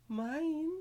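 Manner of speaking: high, put-on coy offer; teasing offer. Sora offering his own panties, teasing. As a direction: lift it high after a pause, teasing.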